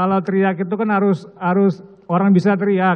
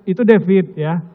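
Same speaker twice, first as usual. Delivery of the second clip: ordinary talk, nothing marked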